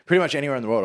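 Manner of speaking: ordinary delivery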